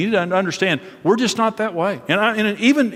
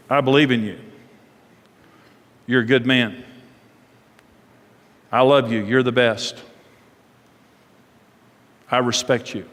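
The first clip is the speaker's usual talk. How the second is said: low, steady, sincere affirmation; respectful affirmation; 6 semitones lower than usual. Shown as a demonstration. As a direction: honor a man with unhurried praise, pausing after each phrase. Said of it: "the way you say it's more important".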